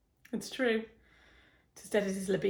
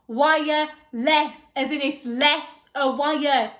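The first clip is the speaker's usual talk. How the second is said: "Wireless" stretched slowly and loudly, split into wire and less; exasperated over-enunciation; 4 semitones higher, 8 dB louder, much slower than usual. Acted. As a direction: slowly hammer home "Wireless" as if to a dimwit. Explaining the word to husband.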